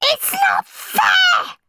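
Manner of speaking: slow, very high, loud shriek. A narrator's reading. Henry protesting to Dad, shrieking in outrage. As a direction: shriek it, stretching the last word. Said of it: "shrieked Henry".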